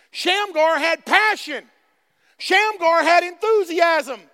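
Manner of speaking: loud, emphatic preacher delivery; slow, punched-out preaching; full-force preaching. Acted. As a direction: drive each phrase home to the congregation.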